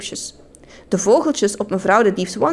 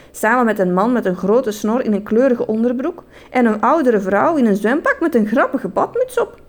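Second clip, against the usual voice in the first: usual speaking voice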